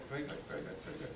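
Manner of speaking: hushed praise. Real, not acted. Praising student during a live demo.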